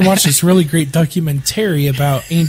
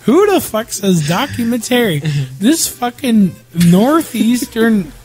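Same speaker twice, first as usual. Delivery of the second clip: high, slow, drawn-out disbelief; slow high-pitched mock outrage; exasperated rant; 6 semitones higher, slower than usual. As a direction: rant slowly at the offending word. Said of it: "I was like".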